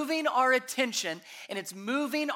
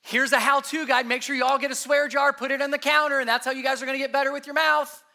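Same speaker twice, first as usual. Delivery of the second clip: louder, brisk mock self-help tip; preacher mocking a how-to guide, louder and faster; 5 semitones higher, 5 dB louder than usual. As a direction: rattle off a pushy to-do list. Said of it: "He's not giving".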